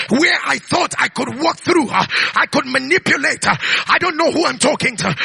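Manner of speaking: building, forceful sermon delivery; fervent pulpit preaching; full-force preaching. Real, not acted. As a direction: preach it out in punched phrases.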